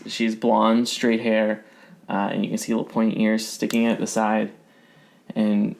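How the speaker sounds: ordinary talk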